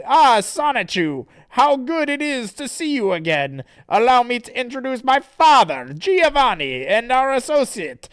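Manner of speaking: high, loud theatrical villain; Nate. Acted. Villain introduces his father and associate, grandiose. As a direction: grandly present like a cartoon villain. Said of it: "And then Nate says".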